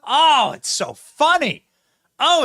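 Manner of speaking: ordinary talk, nothing marked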